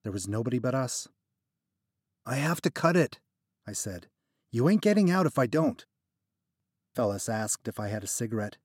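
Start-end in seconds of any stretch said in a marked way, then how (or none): 2.2-5.9 firm insisting. Acted. Narrator insisting.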